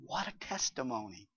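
ordinary delivery

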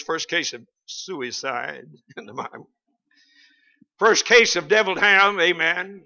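ordinary delivery

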